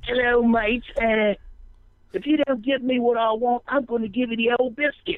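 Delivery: cockney gangster, almost cheerful; mock cockney threat. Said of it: "It's a really cockney".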